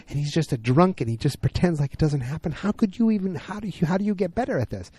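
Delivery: pitched high and strained; tearful pleading. Retold or imitated. Allison begging Peggy for advice, high tearful plea. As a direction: beg for an answer, voice climbing. Allison asks how to cope.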